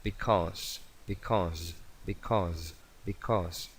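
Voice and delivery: "because" with a buzzed Z ending, soft and low; S sonora. Shown as a demonstration. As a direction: model "because" for learners, voicing the S. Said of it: "es un zumbido". ("because" con la ese como un zumbido)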